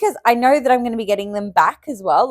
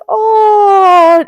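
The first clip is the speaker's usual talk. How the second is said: emotional sigh; 12 semitones higher, 10 dB louder than usual. Retold or imitated. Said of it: "I was getting all emotional".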